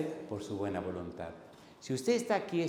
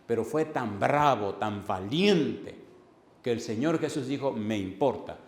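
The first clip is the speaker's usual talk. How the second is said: full-force preaching; 4 semitones higher, 9 dB louder than usual. Acted. Pastor preaching John to the congregation, loud and forceful. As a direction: thunder the verses at the church.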